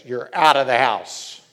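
the judge declares "You're out of the house" in a loud verdict tone. Retold or imitated. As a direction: pronounce it like a judge. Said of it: "The judge declares".

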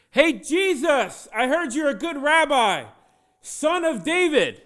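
high, drawn-out holler; full-throated shout. Acted. Blind man calling Jesus son of David, shouting at full force. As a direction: call out as loud as you can. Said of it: "this is what the blind man did".

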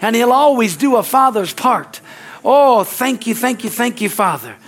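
high, loud, shouted prayer of triumph; preaching at full force. Acted. Pastor praying over the congregation, loud and high. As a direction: rise up and shout praise over the music.

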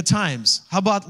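ordinary talk, nothing marked

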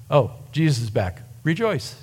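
deadpan, no emotion at all; flat reading. Shown as a demonstration. How we read the Bible, flat and emotionless. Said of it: "just a flat".

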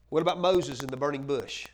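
normal talking voice

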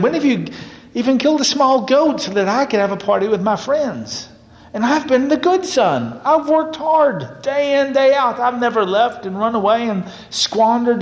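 high, whining, indignant protest; a resentful son railing at his father; bitter complaint. Acted. Older brother protesting to his father over the party, high and whiny.

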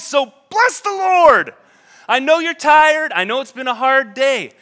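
loud, high rallying call; urging the soul. Acted. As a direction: push a weary soul to rejoice. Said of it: "He's like".

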